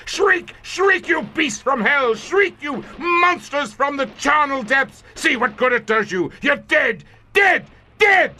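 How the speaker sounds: shrill, screaming, unhinged triumph; maniacal shrieking. Acted. Commander screaming at the dying monsters, maniacal shriek. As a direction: scream it at them like a madman.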